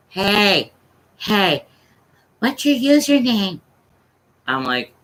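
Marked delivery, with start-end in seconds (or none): 0.1-3.6 lightly voiced little kid; a hint of a child calling out. Little kid on the headset to an adult, lightly voiced. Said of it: "I can hear this little kid".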